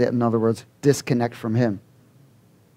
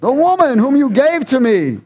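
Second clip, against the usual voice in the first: much higher, louder, whining excuse; whiny Adam; 11 semitones higher, 4 dB louder than usual. Acted. Adam blames the woman before the Lord, whining high. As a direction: point the finger like a caught child.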